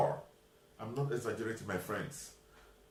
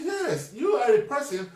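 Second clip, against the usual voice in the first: exasperated protest; 10 semitones higher, 7 dB louder than usual. Retold or imitated. Narrator protesting to the clerk.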